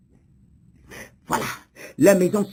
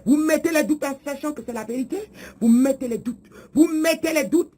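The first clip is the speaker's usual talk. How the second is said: high-pitched, exasperated accusation; annoyed, rising insistence; irritated rebuke; 5 semitones higher than usual. Acted. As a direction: hammer the repeated accusation with mounting irritation. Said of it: "c'est ça qui m'énerve".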